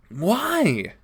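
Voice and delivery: ordinary delivery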